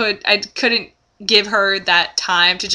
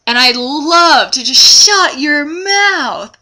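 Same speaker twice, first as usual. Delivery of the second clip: worked-up vent; 5 semitones higher, 7 dB louder, much slower than usual. Real, not acted. Fantasising about snapping at the woman.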